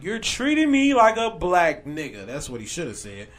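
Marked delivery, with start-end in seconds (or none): 0.0-2.3 slow mock yell, higher and louder; retold yell. Friend retells the arrested man's line, slow mock yell. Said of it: "he yells out".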